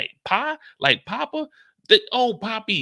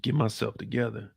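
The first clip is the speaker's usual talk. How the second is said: calm yourself after a scare; shaken; 3 semitones lower than usual. Acted. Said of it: "had me scared".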